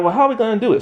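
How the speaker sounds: high-pitched, lightly exasperated; high exasperated question. Retold or imitated. Kids stuck on saving the past, mock exasperated. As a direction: pitch it up, half-hearted helplessness. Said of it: "they're like".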